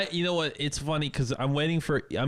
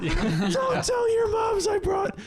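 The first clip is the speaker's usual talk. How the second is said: high, loud panicky shriek; panicked mom; 3 dB louder, slower than usual. Retold or imitated. Mom begging the boys, high panicked shout. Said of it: "the mom is just going".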